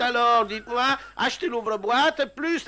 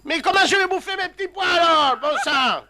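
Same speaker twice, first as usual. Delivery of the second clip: rising, louder exasperated complaint; exasperated outburst; 7 semitones higher, 4 dB louder than usual. Acted. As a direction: blow up in frustration about dinner. Exasperated customer complaining about can-opener.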